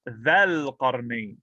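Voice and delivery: dhal qarnayn slow, with a in dhal; mansub form. Shown as a demonstration. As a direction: draw out dhal with its a vowel.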